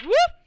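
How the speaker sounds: high, loud rising whoop; short yelp leaping up in pitch; sudden whoop. Real, not acted. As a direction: let out a quick whoop. Game master's whoop while rolling dice.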